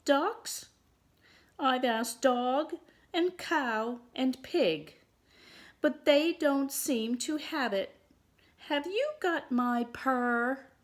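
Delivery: high-pitched storybook kitten; small kitten's high, questioning voice; little kitten asking. Acted. Kitten asks the ducks.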